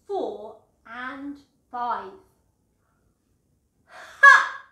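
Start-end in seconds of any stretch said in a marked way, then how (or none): 3.8-4.6 one huge, much higher and louder mocking "Ha!"; giant's booming laugh. A narrator's reading. Goliath mocks David with a loud booming laugh. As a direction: bellow a scornful laugh at the boy. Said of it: "laughed the giant".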